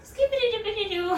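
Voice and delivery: playful mock kid rhyme; sing-song Laura. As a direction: chirp it like a carefree little girl. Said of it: "reprising Laura's catchphrase".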